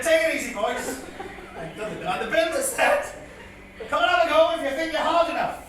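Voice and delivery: projected, singsong ballad telling; comic poem recitation. Acted. Comedian reciting his dad's old bit as a tribute, declaimed comic verse. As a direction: declaim the rhyme to a crowd.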